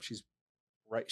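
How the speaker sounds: ordinary talk, nothing marked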